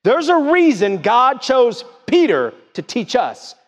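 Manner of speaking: full-force pulpit shout; preaching shout. Acted. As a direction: lift it to a shout on the point. Preacher peaks, shout heard.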